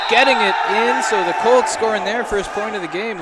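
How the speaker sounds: loud, high excited call; excited shout. Real, not acted. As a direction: yell it as the point lands. Home team scores first point.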